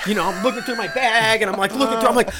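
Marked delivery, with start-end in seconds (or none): none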